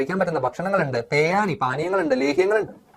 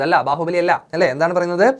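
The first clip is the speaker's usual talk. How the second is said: ordinary talk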